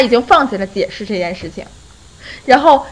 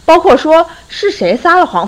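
ordinary talk

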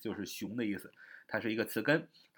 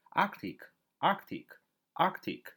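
"Arctic" slow, higher on its first syllable; stress on first syllable; 6 semitones higher, much slower than usual. Shown as a demonstration. Teacher drills where the stress goes, front-stressed slow "Arctic". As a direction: repeat "Arctic" with space between, stressing the front. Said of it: "重音在最前面".

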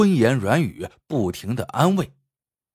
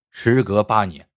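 usual speaking voice

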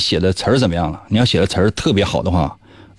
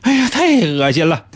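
high squealing disgust through laughter; annoyed ugh. Real, not acted. (尖着嗓子嫌弃地喊恶心)

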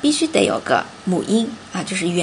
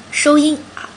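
normal talking voice

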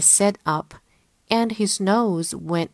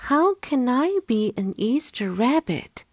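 higher bunny voice, puzzled; 6 semitones higher than usual. Acted. Bunny wondering how to become an Easter rabbit, worried.

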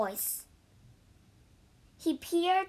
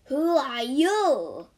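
slow, loud monster question; gruff monster challenge; 7 dB louder, slower than usual. A narrator's reading. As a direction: demand it slowly at the door. Monster answers the door.

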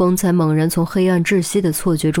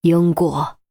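slowly. A narrator's reading. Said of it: "缓缓吐出两个字".

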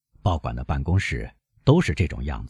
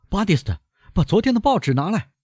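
calling out; faster than usual. A narrator's reading. Beauchamp calls his servant for yesterday's paper, raised call. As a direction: call across the office, pitched high. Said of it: "博尚喊道".

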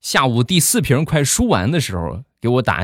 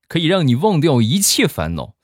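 ordinary delivery

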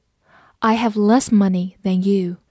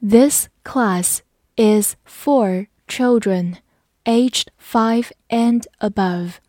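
word by word for repeating; slow model sentence with evenly spaced words; slow read-along; much slower than usual. Shown as a demonstration. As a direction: lead learners through it slowly. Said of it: "跟我慢读一遍".

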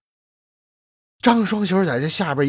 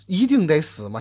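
usual speaking voice

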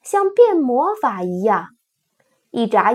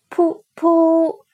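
horn toot sound; 5 semitones higher than usual. Acted. Imitating a paper horn.

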